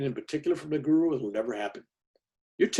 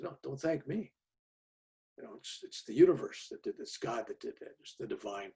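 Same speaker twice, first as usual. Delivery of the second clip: soft humility; 10 dB quieter than usual. Retold or imitated. Deflecting thanks humbly.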